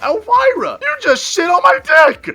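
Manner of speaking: screaming high and loud in outrage; furious scream at the girlfriend who soiled him; furious shout. Retold or imitated. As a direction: yell it at her, disgusted and betrayed.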